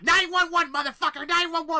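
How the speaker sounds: very high, loud shriek; shrieked threat. Retold or imitated. Roommate shrieking that she'll call the police. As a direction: screech the threat.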